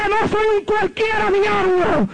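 high shout at the devil; preacher reenacting his cry at the demon; defiant shout. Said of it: "Y le grité".

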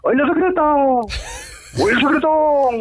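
jeering holler at undercover cops; mocking shout. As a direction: shout it out teasingly from the bike. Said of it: "y les gritaba".

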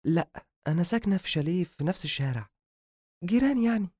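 high-voiced female character; female doctor's voice. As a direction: introduce yourself as a young woman doctor, pitched high.

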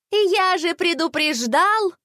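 slow, high-pitched teasing echo; mocking chorus. A narrator's reading. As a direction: mimic a know-it-all, stretched out and high. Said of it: "Хором закончили за него воробушки".

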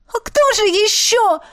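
high-pitched hurt shout; indignant cry. A narrator's reading. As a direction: cry out, outraged. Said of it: "выкрикнула я".

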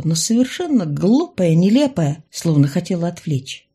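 ordinary delivery, nothing marked

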